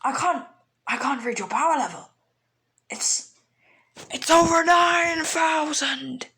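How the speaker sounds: crank it up to a hammed-up over nine thousand; mock power-level panic. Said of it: "Raditz is like".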